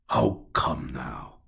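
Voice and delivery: slow, mocking, playful reproach; mocking scold. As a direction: tease her like a patronizing elder. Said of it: "he mockingly scolded her".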